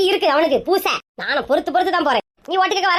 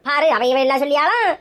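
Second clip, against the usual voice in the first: usual speaking voice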